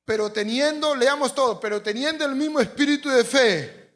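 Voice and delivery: loud, high congregational reading; forceful scripture reading. Real, not acted. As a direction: lead the crowd reading aloud. Leads congregation reading verse aloud.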